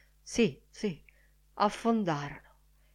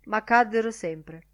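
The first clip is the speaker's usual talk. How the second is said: ordinary delivery, nothing marked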